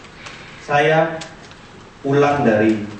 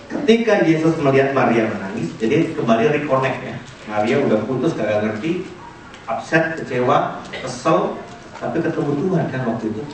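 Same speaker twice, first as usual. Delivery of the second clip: ordinary talk, nothing marked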